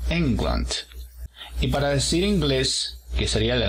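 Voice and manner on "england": England with a strong Eng; stress on the first syllable. Shown as a demonstration. Teacher models the country name, first syllable strong. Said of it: "en la primera sílaba".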